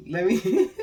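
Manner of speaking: breaking into a laugh mid-sentence. Real, not acted. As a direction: giggle through the words. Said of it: "I'm not laughing".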